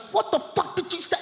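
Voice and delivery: mock Lila squaring up; angry friend. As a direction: friend snapping at the woman. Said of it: "Now Lila goes".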